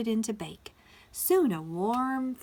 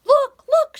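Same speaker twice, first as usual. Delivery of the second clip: very high, loud squawk; hen's squawk; 18 dB louder than usual. A narrator's reading. Little Red Hen alerting the other animals, squawking. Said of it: "she squawked to the other animals".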